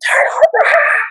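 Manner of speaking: imitated teacher's shriek, very high; screaming. Teacher screams at the kid in class, imitated high scream. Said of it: "My teacher was screaming at me".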